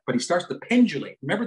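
normal talking voice

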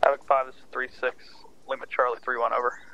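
radio voice. Real, not acted. Radio reply over comms.

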